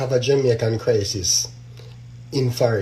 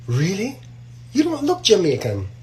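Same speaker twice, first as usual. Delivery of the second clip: mock surprise; 5 semitones higher than usual. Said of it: "What you think the woman say?".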